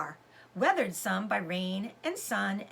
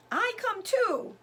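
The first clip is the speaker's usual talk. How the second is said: put-on high, slow little-girl voice; small child voice; 10 semitones higher, 3 dB louder, slower than usual. A narrator's reading. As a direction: lisp it out like a toddler.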